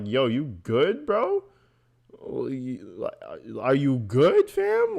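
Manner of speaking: a hint of a worried buddy checking in; concerned cellmate. Commentator imagines himself checking on Paul, mock worried. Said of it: "I would be over there in the corner like".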